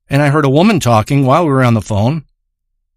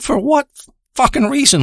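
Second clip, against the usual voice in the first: pitched way up on for what fucking reason; exasperated outburst; 10 semitones higher than usual. Retold or imitated. Fed up friend to Lyle on the phone, exasperated high outburst. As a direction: blurt it out, fed up with the favor. Narrator getting annoyed at Lyle.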